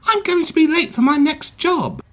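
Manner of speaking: high, loud fretful voice; hurried. A narrator's reading. Small engine is held up, fretful. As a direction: fret about being late. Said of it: "Percy came up in a hurry".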